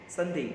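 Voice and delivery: संडे said clearly as sun-day, ending in a plain e; संडे as sun-day, the e clean and short; correct Sunday. Shown as a demonstration. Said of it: "इसका सही उच्चारण संडे ही होता है".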